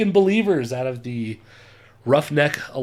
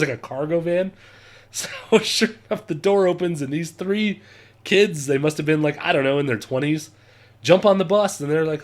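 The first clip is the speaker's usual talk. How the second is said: normal talking voice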